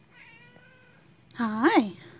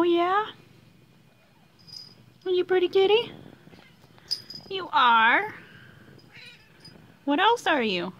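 very high, sing-song cooing; 7 semitones higher, 4 dB louder than usual. Real, not acted. Coaxing the cat to come over in squeaky baby talk.

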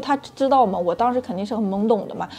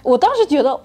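usual speaking voice

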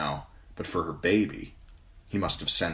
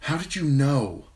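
trembling; 9 semitones higher, 4 dB louder than usual. A narrator's reading.

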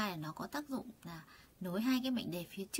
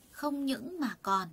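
Không những mà còn spelled out very slowly; slow, stressed translation; 3 semitones higher, much slower than usual. Shown as a demonstration. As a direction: lay out each word of Không những mà còn. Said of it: "người ta dịch là cái gì nhỉ?".

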